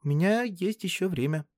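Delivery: put-on high, earnest girl's voice; serious girl. A narrator's reading. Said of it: "серьёзно сказала она". (серьёзный девичий голос)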